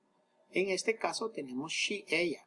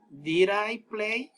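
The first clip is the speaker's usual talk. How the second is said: Did I play? drawn out very slowly and a bit louder; slow model question; 5 semitones higher, 6 dB louder, much slower than usual. Shown as a demonstration. Teacher models an English question for students, very slow. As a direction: spell the question out word by word for the class. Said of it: "Un ejemplo más".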